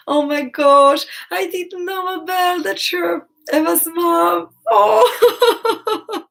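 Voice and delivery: emotional excited gushing; overjoyed. Real, not acted. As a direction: gush with teary delight. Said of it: "now I think I'm blushing".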